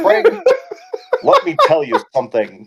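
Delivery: lightly voiced imitation of a friend; Brian impersonation. Co-host playing his friend addressing him, lightly imitated. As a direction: mimic your buddy addressing you. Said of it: "how we talk when we're Brian".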